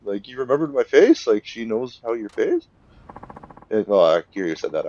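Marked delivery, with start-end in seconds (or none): 0.0-2.7 high, loud startled squawk; shocked. Retold or imitated. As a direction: blurt it out in disbelief. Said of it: "And he's like".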